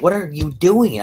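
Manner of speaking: high, loud mock outburst; exasperated disbelief. Imitating himself scolding a bawling ally, exasperated disbelief. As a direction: burst out in high-pitched disbelief. Baffled at teary sidekick, acted.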